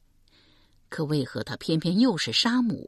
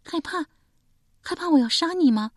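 put-on higher, incredulous young woman's question; young woman puzzled by his fear, higher voice; 12 semitones higher, 3 dB louder than usual. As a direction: ask back in disbelief. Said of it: "很是不解道".

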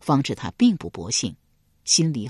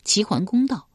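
ordinary delivery

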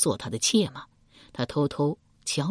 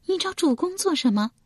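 puzzled question; 13 semitones higher than usual. A narrator's reading. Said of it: "蔡新满面困惑道".

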